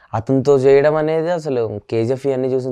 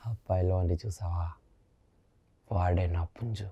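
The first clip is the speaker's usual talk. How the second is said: quiet, lower, deliberate movie-villain line reading; film dialogue recital; 4 semitones lower, 11 dB quieter than usual. Pretend. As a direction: hush it down and let each threat land after a pause. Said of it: "చెప్పగలుగుతారా ఇప్పుడు?".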